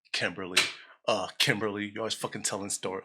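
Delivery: low, hushed office gossip; gossipy voice. Acted. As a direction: dish the dirt like an office gossip. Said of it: "What's a gossipy voice?".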